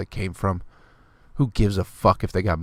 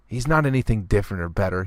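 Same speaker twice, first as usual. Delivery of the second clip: normal talking voice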